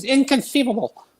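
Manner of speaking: high squeaky inconceivable; lightly voiced Vizzini; Vizzini impression. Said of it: "The Princess Bride".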